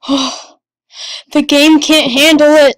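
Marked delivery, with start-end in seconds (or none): 1.3-2.8 much louder, excited outburst; loud, overwhelmed cry of excitement; loud exclamation. Real, not acted. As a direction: blurt it out at full volume. Repeats line loudly, then whispered.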